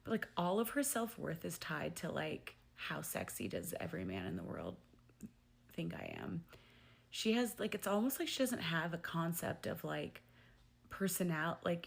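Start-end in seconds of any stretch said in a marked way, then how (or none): 2.7-6.4 low, drawn-down mock of her vanity; mock vain voice. Mocking the contestant's vanity, lower and sneering. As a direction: sneer out her inner thought.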